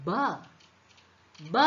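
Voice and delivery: isolated "ബ", the sound of B; teacher modelling "ബ" as a phonics sound; letter sound of B. Shown as a demonstration. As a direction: say "ബ" alone, pause, repeat it. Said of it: "ബി എങ്ങനെയാ pronounce ചെയ്യുന്നത്?".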